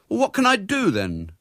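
put-on high, loud boyish demand; indignant child's question. A narrator's reading. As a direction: demand an answer like a thwarted boy. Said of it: "demanded William".

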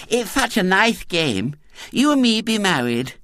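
put-on high, lisping, eager child; eager little girl. A narrator's reading. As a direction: pitch an idea like a keen small girl. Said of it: "said Violet Elizabeth eagerly".

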